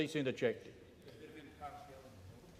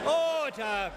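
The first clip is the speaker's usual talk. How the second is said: shouting order order; 7 semitones higher than usual. Real, not acted. Speaker shouting over rowdy chamber.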